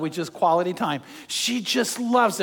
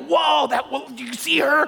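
higher-pitched, hammed-up amazement; mock going nuts; 5 semitones higher than usual. Men spotting a beautiful woman, mock awestruck. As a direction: act out a guy losing it. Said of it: "And we go nuts".

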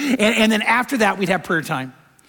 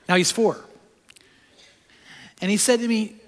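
ordinary talk

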